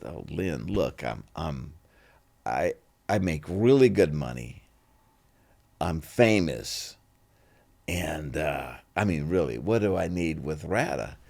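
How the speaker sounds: low, quiet, hesitant protest; retold modest-toned boast; self-important brush-off. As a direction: reason with her quietly, pitched well down. Retells his own reluctant reply.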